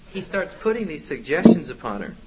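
normal talking voice

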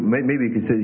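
ordinary talk

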